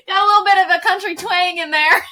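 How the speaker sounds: loud laughing admission. Real, not acted. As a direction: laugh it out, fast. Laughing over own accent.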